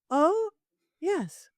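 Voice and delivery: nervous high oh. Retold or imitated. Psychic caught out by her corporate client, nervous and high. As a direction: squeak it out, caught off guard. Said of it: "my first call".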